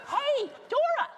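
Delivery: cheery greeting. Real, not acted. Greeting a latecomer.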